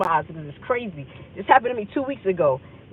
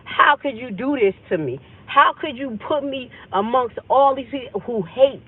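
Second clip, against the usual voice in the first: raised, indignant pleading; higher-pitched, accusing reproach; retold anger at God; 5 semitones higher, 4 dB louder than usual. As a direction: demand an answer from God, hurt and mad. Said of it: "I'm mad at God".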